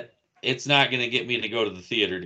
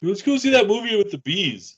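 mock dad; 9 semitones higher than usual. Imitating dad suggesting a movie.

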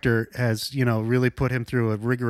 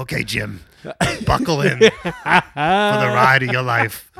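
animatronic Roma voice; 2 semitones lower, slower than usual. Pretend.

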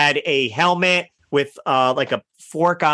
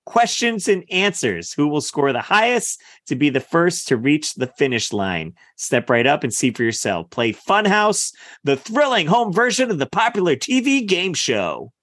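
voiceover announcer. Acted. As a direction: sell it like a TV commercial announcer. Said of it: "Somebody hire this man to do voiceover work".

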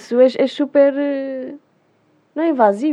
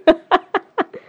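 high laugh; 9 semitones higher than usual. Real, not acted. Laughs at the pick-up line.

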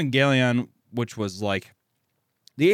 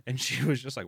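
ordinary delivery

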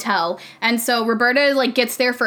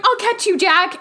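mock reassuring; 9 semitones higher than usual. Voicing Roberta reassuring the boy.

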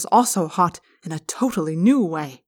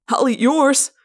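higher-pitched greedy outburst; eager cry; 6 semitones higher, 4 dB louder, slower than usual. Acted. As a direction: cry out, desperate for more. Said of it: "I cried".

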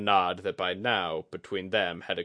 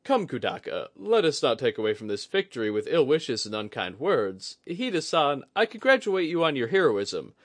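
jovial placating; 6 semitones higher, 4 dB louder than usual. Acted. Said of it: "a joviality injected into it".

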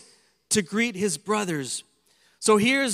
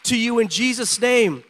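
rising preacher's exhortation; fervent sermon delivery; preaching; 3 semitones lower than usual. Acted. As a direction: preach hope to the congregation. Preaching at full force.

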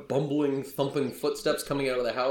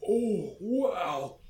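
put-on high-pitched childlike wonder; gape, then burst out louder and higher; awestruck bumbling son; 10 semitones higher, 6 dB louder, much slower than usual. Big oafish son meeting adventurers, awestruck high voice. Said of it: "he stops dead in his tracks".

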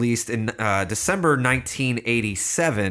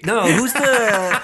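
high, slow, laughing through the words; 11 semitones higher, 6 dB louder, much slower than usual. Real, not acted. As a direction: laugh while searching for a name. Laughing while groping for a name.

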